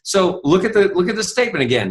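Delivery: ordinary delivery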